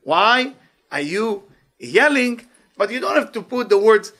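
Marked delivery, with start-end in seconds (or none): 0.0-2.5 "Why are you yelling" slow, higher and louder, word by word; deliberate, drawn-out "Why are you yelling"; short version, spaced out. Shown as a demonstration. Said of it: "It's enough to say".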